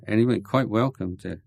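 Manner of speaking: ordinary delivery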